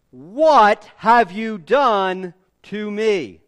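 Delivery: loud and slow, indignant king; a furious pharaoh confronts Abraham; Pharaoh's angry demand. Acted. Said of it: "he said".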